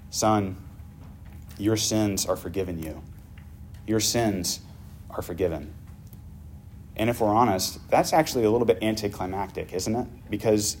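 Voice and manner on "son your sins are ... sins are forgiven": tender. Acted. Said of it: "you can hear the tenderness in Jesus' voice".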